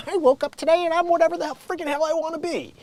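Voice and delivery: high, hurried mocking voice; mocking whiner. Host mimics someone declaring who they are today, mocking. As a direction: rattle it off in a high-pitched put-on voice. Mocks people declaring an identity.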